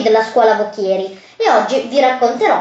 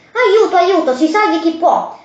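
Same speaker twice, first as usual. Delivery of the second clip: mock frightened cry for help; 6 semitones higher than usual. Mouse cries for help.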